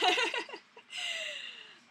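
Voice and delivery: squealing high giggle; bright burst of giggling; high laugh. Real, not acted. Laughing at her own joke.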